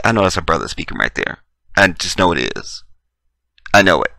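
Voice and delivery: low, soft, sure of it; quiet knowing voice. Acted. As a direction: murmur it, fully convinced. Quoted listener, knowing.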